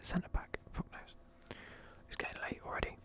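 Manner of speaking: normal talking voice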